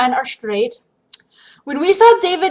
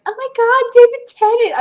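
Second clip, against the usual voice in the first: high, breathless fan squeal; fangirl squeal; 12 semitones higher than usual. Shown as a demonstration. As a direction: gush over the actor like a fan. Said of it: "impersonating my friends".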